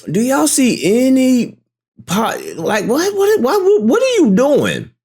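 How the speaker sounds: high, disbelieving yelps; can't believe what he's hearing; incredulous outburst. Real, not acted. Exasperated at [name]'s defence of [name].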